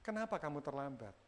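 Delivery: low, soft caring question; gentle, hushed concern; empathy. Shown as a demonstration. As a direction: ask quietly, wanting to help. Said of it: "yang satu empati, kepingin menolong".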